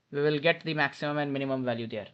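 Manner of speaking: usual speaking voice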